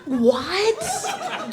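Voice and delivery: normal talking voice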